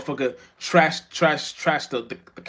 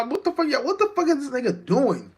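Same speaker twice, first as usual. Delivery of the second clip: outraged disbelief; 11 semitones higher, 4 dB louder than usual. Acted.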